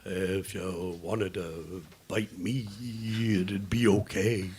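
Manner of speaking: very soft, low and slow; shy offer. Acted. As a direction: murmur a tentative invitation. Shyly offers himself to be bitten.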